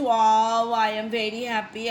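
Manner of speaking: a loud wow, then the happy line; excited exclamation. Shown as a demonstration. Teacher showing students an exclamatory sentence, excited. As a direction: burst out with wow as if thrilled. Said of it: "When you express".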